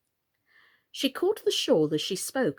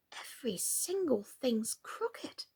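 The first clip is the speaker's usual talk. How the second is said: soft slow whisper to herself; 4 semitones higher, 8 dB quieter, slower than usual. A narrator's reading. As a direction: breathe it as a private thought. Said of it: "Alice thought to herself".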